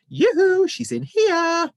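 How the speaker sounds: very high, loud teasing sing-song call; mocking call giving her away; sing-song yoo-hoo. A narrator's reading. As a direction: sing it out like a flashing sign. Imagined sign calling out.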